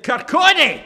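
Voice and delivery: high, loud scornful Kirkcaldy; disgusted surprise. Retold or imitated. Train woman scoffing at the speaker's home town, high and loud. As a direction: sneer at the town name. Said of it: "And she said".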